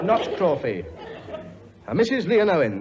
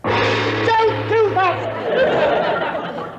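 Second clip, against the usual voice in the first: startled shout; 4 dB louder, much slower than usual. Acted. King startled by herald.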